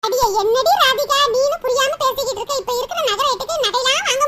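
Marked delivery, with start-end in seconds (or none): none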